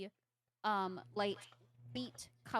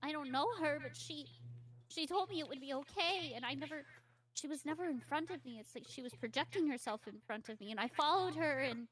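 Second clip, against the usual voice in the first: higher voice, repeating and stumbling; frightened child; 6 semitones higher, 3 dB louder, faster than usual. Acted. Lost girl describing her captor, anxious and higher.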